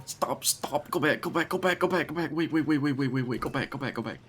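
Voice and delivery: frantic. Real, not acted. Missed the boat in game.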